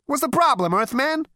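brash alien mocking the Earthman; brash Zaphod. A narrator's reading. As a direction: toss it off with swagger. Zaphod speaks.

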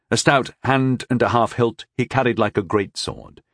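plain reading voice

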